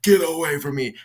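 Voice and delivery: high, rising panicked shout of get away; a man in a full breakdown, shouting at her to stay away; panicked yelling. Retold or imitated. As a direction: scream it in terror, backing away. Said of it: "He starts freaking out".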